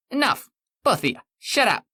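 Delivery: high, commanding shout. Acted. The man in red silences his bickering former selves, shouting. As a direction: cut them both off with a yell. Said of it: "The man in red shouted".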